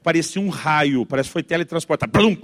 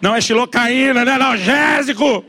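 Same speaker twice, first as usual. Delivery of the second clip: high, rising exasperated yell; outraged shout; 7 semitones higher, 3 dB louder than usual. Acted. Father wanting to debunk mother's healing kiss, outraged yell. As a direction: build into a screaming protest. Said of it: "eu quero gritar pra Deus e o mundo".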